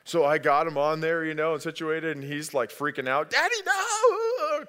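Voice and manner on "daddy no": put-on high wailing child's cry of no; scared kid crying. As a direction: wail it like a terrified toddler.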